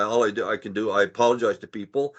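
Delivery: ordinary delivery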